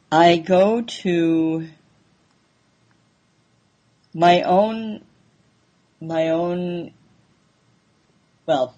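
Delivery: halting, stuck on words; stuttering. Real, not acted. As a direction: stammer and restart. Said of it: "so I'm stuttering".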